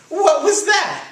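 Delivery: high, loud astonished question; startled cartoon outburst; exclaiming. A narrator's reading. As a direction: cry it out in amazement. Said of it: "exclaimed Skippy John Jones".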